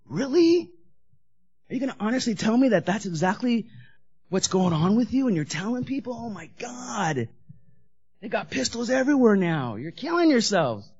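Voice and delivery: sneering at the AA sharers; scoffing disbelief. Retold or imitated. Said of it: "looking around going".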